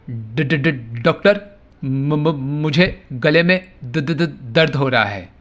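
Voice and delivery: stammering patient, stuck on first sounds; acted stammer. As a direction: imitate a stutter, repeating the opening consonant before getting the word out. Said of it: "sounds को इनवॉलंटरीली यानी ना चाहते हुए repeat". (हकलाते हुए मरीज़ की नकल)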